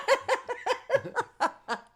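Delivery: loud high-pitched laugh. Real, not acted. Laugh after the brag.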